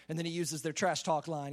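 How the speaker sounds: ordinary delivery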